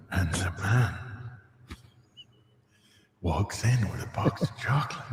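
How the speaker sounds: seductive chocolate commercial voiceover. Shown as a demonstration. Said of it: "the chocolates voice you did for the".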